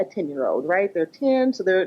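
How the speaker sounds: ordinary talk, nothing marked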